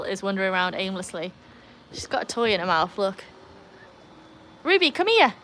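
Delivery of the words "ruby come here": high, loud call to the dog. Real, not acted. Owner calling her dog Ruby over.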